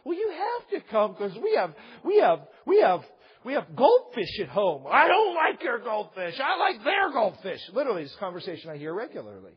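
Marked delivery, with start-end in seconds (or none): 0.0-4.5 high-pitched mock parental coaxing; pleading parent. Parent coaxes kid out of daycare, pleading and high. 4.9-7.6 mock bratty protest, raised voice; whining kid. Kid rejects the parent's goldfish bribe, loud mock whining. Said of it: "and their kids are like".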